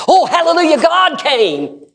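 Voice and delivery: high, loud joyful cry; ecstatic hallelujah. Real, not acted. Preacher rejoicing before the church, high exultant cry. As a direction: shout it out in rapture.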